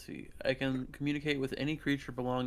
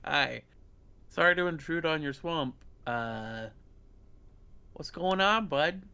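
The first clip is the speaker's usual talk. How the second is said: friendly dragon-speak; 3 semitones higher, 5 dB louder, slower than usual. Acted. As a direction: greet the swamp beast like an old pal. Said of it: "horse-like way you can turn a horse into a reptile".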